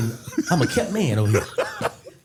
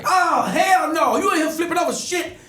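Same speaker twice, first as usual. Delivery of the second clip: acting mad; 4 dB louder than usual. Pretending to be mad at the ex who flipped the table. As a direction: fake a big angry outburst. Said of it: "But I gotta act mad".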